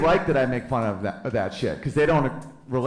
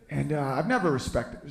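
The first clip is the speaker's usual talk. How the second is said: ordinary talk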